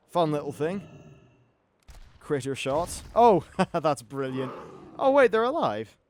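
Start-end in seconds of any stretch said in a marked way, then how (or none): none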